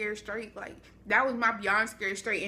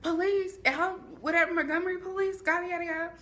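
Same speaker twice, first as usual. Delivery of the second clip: police at the door; 8 semitones higher than usual. Retold or imitated. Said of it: "they're like".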